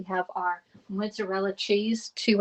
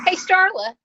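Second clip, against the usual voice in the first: high, loud happy call-out; excited greeting; 12 semitones higher, 7 dB louder than usual. Real, not acted. Host spots a regular viewer in the chat, excited greeting. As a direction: call out brightly to someone you know.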